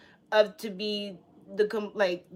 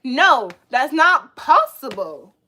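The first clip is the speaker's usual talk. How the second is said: loud, drawn-out, emphatic refusal; mock yelling reply; 3 semitones higher, 6 dB louder, slower than usual. Acting out the answer to the shark, emphatic. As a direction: push each word out loudly and slowly. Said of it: "Yelling doesn't make you correct".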